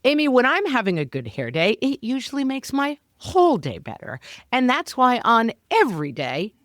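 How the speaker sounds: bright scripted sponsor read. Acted. Co-host starting a Nutrafol ad, cheerful read. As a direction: pitch the product cheerfully. Reads an ad.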